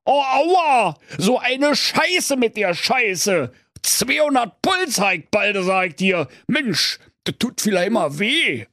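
high, whiny Berlin wail of pain; Kaiser wailing in pain. Acted. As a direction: moan about the belly flop like a spoiled rich man. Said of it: "erklang sein Wehgeschrei". (hoher, jammernder Schmerzensschrei mit Berliner Schnauze)

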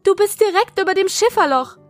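shouting. Acted. Sister shouting a warning.

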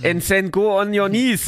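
put-on German accent. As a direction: lay the German accent on thick in English. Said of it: "mit deutschem Akzent Englisch geredet".

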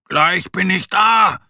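höher, lauter und langsam nach oben gerufen; zugerufen. Acted. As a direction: ruf den Küken beruhigend zu. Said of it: "ruft er den Vogelkindern zu". (lauter Zuruf zu den Vogelkindern)

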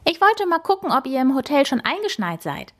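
put-on bouncy young boar, high voice; lively wild boar girl. A narrator's reading. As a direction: rattle it off, pitched high and excited.

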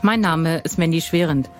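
normal talking voice

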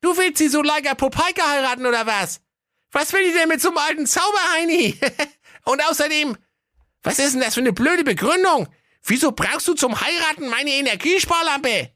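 high, fast mocking boy voice; mocking lad. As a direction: tease the old wizard. Aladdin mocks wizard.

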